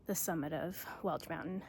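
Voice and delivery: winded from the steep climb; panting between words; out of breath. Real, not acted. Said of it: "Whew, I'm out of breath".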